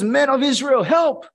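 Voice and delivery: high, loud cry for help. Retold or imitated. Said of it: "they cried out".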